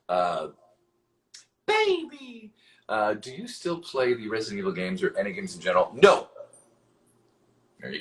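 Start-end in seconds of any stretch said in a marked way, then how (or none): 1.7-2.5 very high silly squeak; drawn-out falsetto cooing; playful falsetto. Pretend. Playful outburst between questions. 5.9-6.3 loud, high blurted answer; abrupt high yelp of refusal; emphatic refusal. Real, not acted. Emphatic comic answer to read question.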